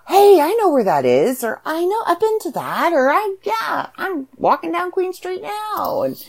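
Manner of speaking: high, eager reader voice; light, animated recognizing voice; excited recognition. Retold or imitated. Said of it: "read that and go".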